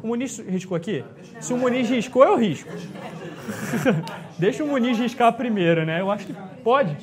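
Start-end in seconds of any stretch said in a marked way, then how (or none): 2.7-4.3 quiet breathy chuckle; soft laugh. Real, not acted. Teacher chuckles at a student's joke, softly. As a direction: let a hushed laugh slip out. Joking about Muniz.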